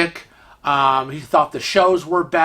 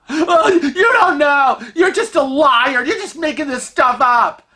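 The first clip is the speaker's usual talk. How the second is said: high, loud indignant outburst; mock screaming; 9 semitones higher, 4 dB louder than usual. Stranger calling husband a liar, screaming. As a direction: cut in with hammed-up shrill accusations. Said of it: "she's just screaming on and on".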